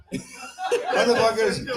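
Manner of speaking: ordinary talk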